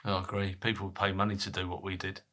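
ordinary talk, nothing marked

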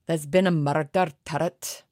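hammed-up Scottish accent. Shown as a demonstration. American podcaster goaded by co-host, hammed-up Scottish accent. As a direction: put on a playful Scottish accent. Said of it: "I can do the accent".

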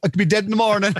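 high, breezy Irish brush-off; self-deprecating Irish. Retold or imitated. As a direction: shrug it off in a high Irish lilt. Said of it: "I'm doing the usual".

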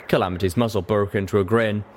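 usual speaking voice

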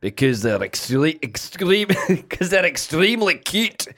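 put-on a lightly done Scottish accent breaking into laughs. A host trying Scottish for the accent game names the bush baby, laughing, in a light Scottish accent. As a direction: push through a Scottish brogue while giggling. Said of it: "can I do Scottish?".

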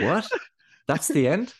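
imitating surprised listeners. Listeners reacting to the abrupt ending, mock surprise. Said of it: "And everybody's like".

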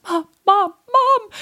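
put-on high, loud kid calling for Mom; freaking out. Twelve-year-old runs downstairs to Mom, high frantic calls. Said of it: "hysterically laughing and almost, like, freaking out".

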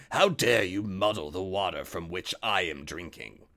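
low menacing wolf. A narrator's reading. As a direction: growl the accusation as the wolf. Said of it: "he called out to the lamb".

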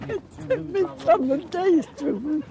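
put-on very high, soft, frail old lady; thin trembling complaint; Auntie Ruby. Great-aunt on the old recording, very high and soft. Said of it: "recording of her".

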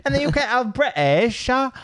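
hammed-up British accent, high. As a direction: play a silly posh British tourist. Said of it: "doing that British accent".

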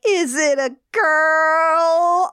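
high, slow, drawn-out teasing question; teasing mom. Retold or imitated. Mom prying into her son's love life, teasingly drawn out. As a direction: stretch it into a high singsong tease.

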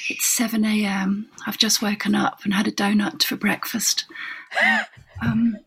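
put-on affect a breathy posh accent over trivial news; teen girl faking posh on a tape recording; trying-to-sound-posh voice. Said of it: "so I'm putting on this posh voice".

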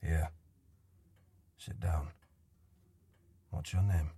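put-on low, soft voice of a gentle old man; a weary old man coaxing a stranger softly; quiet old man. Old man character speaks.

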